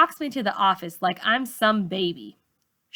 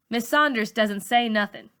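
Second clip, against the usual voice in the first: plain reading voice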